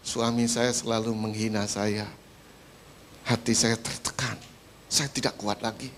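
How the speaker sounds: mild mock weeping complaint; tearful wife. Wife confiding hurt to the pastor, understated tearful. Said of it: "Nangis saja Ibu itu".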